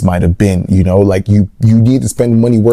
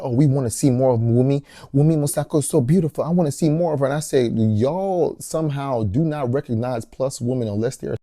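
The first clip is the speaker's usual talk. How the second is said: softer mocking quote; 2 semitones higher, 10 dB quieter than usual. Quoting fans, mocking.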